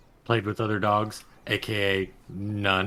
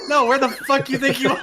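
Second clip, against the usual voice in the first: laughing through a rude retort; giggling angry clerk voice; laughing mock shop worker; 7 dB louder than usual. Imagined shop worker scolding.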